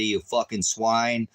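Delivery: ordinary talk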